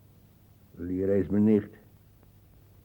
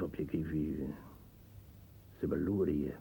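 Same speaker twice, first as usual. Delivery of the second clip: zacht gefluisterd, samenzweerderig; fluisteren; 4 semitones lower, 10 dB quieter than usual. Acted. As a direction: fluister het als een geheim. Samenzweerderig over de bovenburen. (samenzweerderig gefluisterd)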